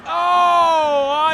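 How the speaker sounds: loud high Oh of surprise; excited shout. Real, not acted. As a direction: react to the missed dive. Missed knee drop off the rope.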